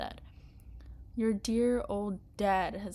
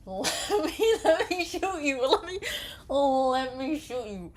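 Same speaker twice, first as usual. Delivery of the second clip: loud, higher begging through laughter; laughing plea; 7 semitones higher, 6 dB louder than usual. Real, not acted. Player begging the game to let her shoot, laughing. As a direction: plead to shoot him, laughing.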